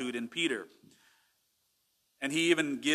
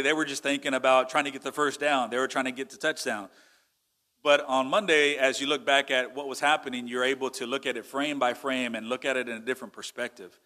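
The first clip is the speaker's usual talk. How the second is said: ordinary talk, nothing marked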